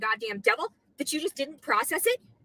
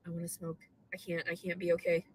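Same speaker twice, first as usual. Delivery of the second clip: exhausted murmur; 7 semitones lower, 10 dB quieter than usual. Real, not acted. Exhausted after crying.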